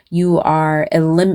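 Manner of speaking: usual speaking voice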